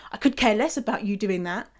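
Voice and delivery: ordinary delivery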